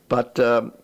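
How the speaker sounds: normal talking voice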